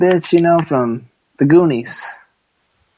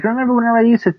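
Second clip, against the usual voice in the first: higher-pitched acted mother voice; Sloth's mother; 7 semitones higher than usual. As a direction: play the mother coaxing with old bedtime memories. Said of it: "and she's like".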